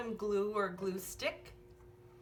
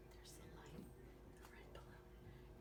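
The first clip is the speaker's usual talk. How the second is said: hushed whisper, softer; 4 semitones lower, 22 dB quieter than usual. Acted. Showing the child the balloon, whispered. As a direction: point it out in a whisper. Whispered aside in bedtime story.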